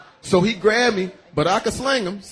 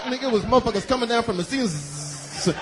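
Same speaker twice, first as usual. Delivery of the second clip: ordinary talk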